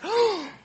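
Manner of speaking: high, quick acted gasp; mock startled gasp. Preacher shows how the disciples did not react, mock gasp. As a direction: gasp in mild surprise. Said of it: "it's not like they're like".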